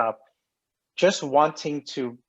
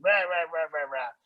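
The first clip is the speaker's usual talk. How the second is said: playful mock grumbling, higher, trailing off; mock cursing; 4 semitones higher, 4 dB quieter than usual. Man mimicking himself cursing the gods over missing ice cream, mock grumble. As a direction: grumble rah rah like cursing the world.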